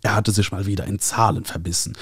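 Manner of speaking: ordinary delivery, nothing marked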